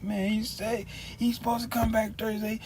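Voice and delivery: high, put-on complaining voice; mimicking the clerk. The clerk complaining to coworkers, high put-on voice. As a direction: whine it like the upset clerk. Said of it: "she was just like".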